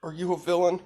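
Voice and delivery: put-on high-pitched, timid child's question; scared kid. Scared kid questions his rescuer, high and timid. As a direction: ask it high, like a nervous kid. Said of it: "he's scared".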